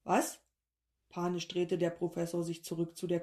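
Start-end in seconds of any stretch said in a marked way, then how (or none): 0.0-0.4 a quick startled question; mild startled what. A narrator's reading. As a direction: jolt out the word. Startled professor.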